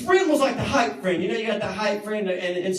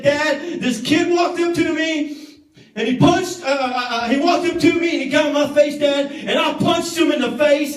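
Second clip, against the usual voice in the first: loud, higher tall tale; boastful lie to dad; 7 semitones higher, 5 dB louder than usual. Retold or imitated. Boy boasting to his father to hide losing, loud. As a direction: puff up the story to save face.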